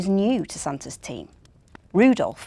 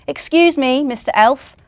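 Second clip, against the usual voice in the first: higher, louder call for attention; 5 semitones higher, 7 dB louder than usual. Acted. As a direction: call after the elf. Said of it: "Russell the reindeer cried out".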